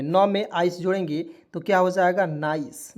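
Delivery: ordinary delivery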